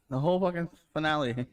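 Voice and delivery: normal talking voice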